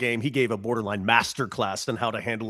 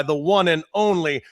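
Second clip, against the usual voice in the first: announcer intro; 4 semitones higher, 6 dB louder, slower than usual. Acted. Announcing guest with flourish.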